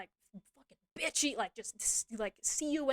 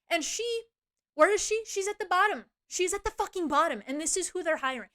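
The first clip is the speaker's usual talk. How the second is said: indignant rant; 7 semitones higher, 6 dB louder than usual. Real, not acted. Ranting about coworker's low sales.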